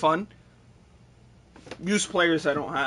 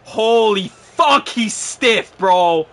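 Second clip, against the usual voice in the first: loud exasperation; 5 semitones higher, 11 dB louder, much slower than usual. Real, not acted. Exasperated at player.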